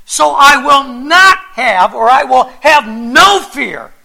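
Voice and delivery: slow, loud, emphatic reading; proclaimed scripture. Acted. Pastor reads Hebrews to his church: slow, bold proclamation. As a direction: declare each word boldly.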